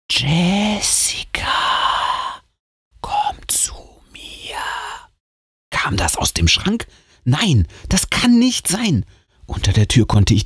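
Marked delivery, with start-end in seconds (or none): 0.1-4.6 slow ghostly whisper; eerie whisper. A narrator's reading. The unseen voice beckons Jessica to come, ghostly whisper. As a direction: lure her closer in a whisper. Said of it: "dieses Flüstern".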